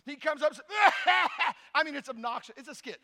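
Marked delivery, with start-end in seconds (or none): 0.6-1.5 loud hammed-up hacking cough; big comic coughing outburst; fake coughing fit. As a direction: cough obnoxiously for the skit. Skit patient's obnoxious coughing fit.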